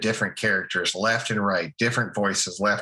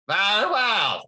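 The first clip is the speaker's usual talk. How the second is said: Monty Python voice; 11 semitones higher, slower than usual. Shown as a demonstration.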